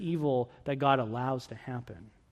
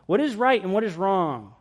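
ordinary talk, nothing marked

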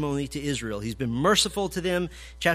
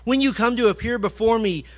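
louder, rising preaching of God's words; forceful scripture reading; 5 dB louder than usual. Acted. Preacher reading Isaiah's rebuke, loud and rising. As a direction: proclaim it as God's indignant voice.

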